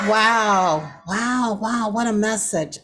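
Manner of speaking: blown away by the speech, let it burst out; real awe. Real, not acted. Awed reaction after the speech.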